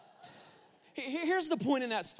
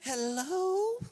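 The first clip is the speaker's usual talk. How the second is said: sweet phone hello; 7 semitones higher than usual. Retold or imitated. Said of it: "trying to say the right thing".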